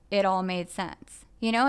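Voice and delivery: ordinary talk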